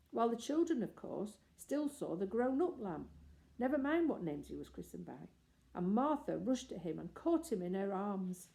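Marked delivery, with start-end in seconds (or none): none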